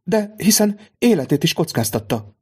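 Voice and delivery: high, protesting objection; protesting lady. A narrator's reading. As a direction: object warmly on the servant's behalf. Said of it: "mondta Villefortné".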